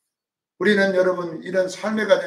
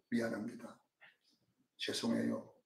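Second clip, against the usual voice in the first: soft, meek apologizing voice; meek apology; 9 semitones lower, 16 dB quieter than usual. Retold or imitated. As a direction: say sorry quietly, backing down. Humble apology in a quarrel.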